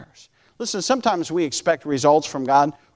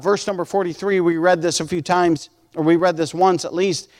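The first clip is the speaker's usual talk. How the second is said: ordinary talk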